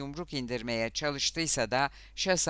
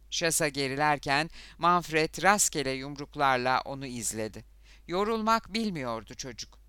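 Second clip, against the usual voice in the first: ordinary delivery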